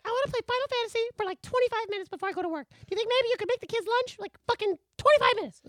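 hammed-up wife begging for game time; mock wife voice. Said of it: "It's just like".

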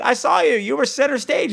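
high-pitched, excited retelling; excited quoted listener. Retold or imitated. Acts out excited listener's message.

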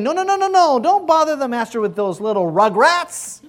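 high, loud no-no-no brushing people off; mock a fussy gatekeeper waving kids away; shooing disciples. Preacher voicing disciples shooing children from the master. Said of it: "his disciples were saying".

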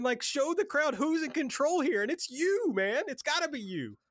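high-pitched, insistent pep talk; fired up. Real, not acted. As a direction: urge him on, worked up and high. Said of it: "I'm just fired up about this".

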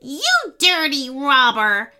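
put-on loud indignant old lady; shouting old lady. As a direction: yell it at the thief. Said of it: "shouted an elderly lady minifigure".

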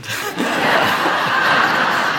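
high breathy laugh breaking out; a big unvoiced laugh; real burst of laughter. Real, not acted. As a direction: laugh out loud at the funny story. Laughing at boy's lion story.

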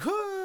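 high, long mock cry; imitated falling wail. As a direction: let out a high cartoon wail of someone dropped. Imitates dropped idol's falling cry.

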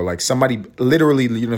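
usual speaking voice

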